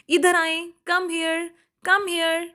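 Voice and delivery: mock parent calling. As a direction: call the kid over from across the room. Parent calling child over.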